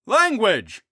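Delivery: high, loud scolding cry; scolding friar. Acted. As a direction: scold her sharply. Steve scolding shout.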